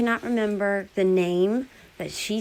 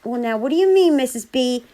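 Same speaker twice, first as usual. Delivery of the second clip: mock innocent surprise; 5 semitones higher, 5 dB louder than usual. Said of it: "she was like".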